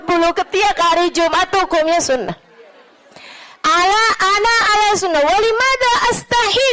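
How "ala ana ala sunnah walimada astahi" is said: high, quick defiant Arabic reply; defiant retort. Retold or imitated. The sheikh answering his accuser in Arabic, defiant. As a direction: answer proudly, unashamed.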